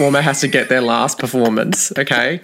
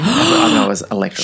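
excited; 11 semitones higher than usual. Real, not acted. Excited retelling.

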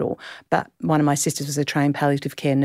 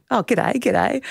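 higher-pitched Australian greeting; Australian accent; 6 semitones higher, slower than usual. Retold or imitated. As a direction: toss out an Australian hello, pitched up. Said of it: "and two Australian accents".

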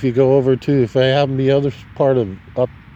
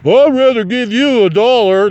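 louder, higher put-on voice of a bigoted guy; 7 semitones higher, 5 dB louder than usual. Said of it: "this one guy was a total racist".

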